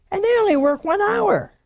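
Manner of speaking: high-pitched indignant complaint; acted-out worker's grievance; grumbling. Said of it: "They grumbled".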